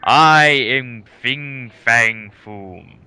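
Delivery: slow, loud booming dragon roar; dragon yell. Retold or imitated. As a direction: boom it down at puny humans. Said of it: "looks down upon you puny humans and yells".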